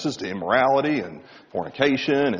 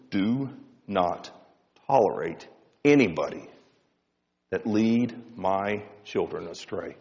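lower, soft, slow fatherly command; stern father voice; 6 semitones lower, 5 dB quieter than usual. Acted. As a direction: crystal clear dad warning, few words. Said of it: "he hit that voice".

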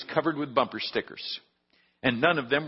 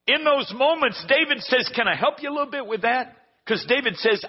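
preaching; 8 semitones higher than usual. Acted. Pastor preaching to his church, raised and fervent. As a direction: lift it to the congregation.

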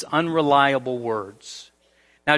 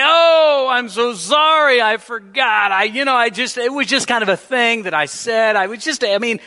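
flustered excuse-maker; 7 semitones higher, 4 dB louder than usual. Retold or imitated. Flaky friend making excuses.

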